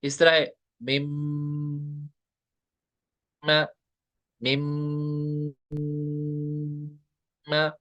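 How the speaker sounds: humming the mim through the nose; nasal demo of मिम and म. Shown as a demonstration. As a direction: hold the m in the nose, a nasal hum. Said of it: "nasal cavity से अदा होगा".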